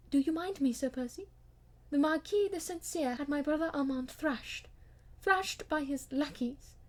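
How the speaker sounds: wife recounts her brother's humiliation to her husband; earnest wife. Acted. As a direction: tell it lovingly, then with rising indignation. Wife tells her brother's story.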